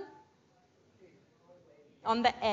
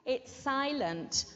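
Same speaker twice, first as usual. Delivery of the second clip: ordinary talk, nothing marked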